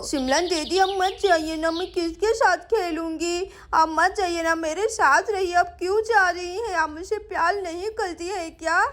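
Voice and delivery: put-on lisping child begging a big sister to stay; pleading little girl. (मनुहार करती छोटी बच्ची की तुतलाती आवाज़)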